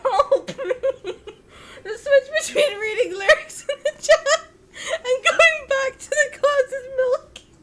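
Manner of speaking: high, loud, breathless laughter; real tears of laughter; crying laughing. Real, not acted. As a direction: laugh until you cry. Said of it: "Oh my god, I'm crying".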